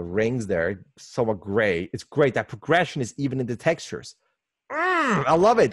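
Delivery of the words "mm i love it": relishing delight. Real, not acted. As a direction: gush with appreciation. Savouring a detail he loves.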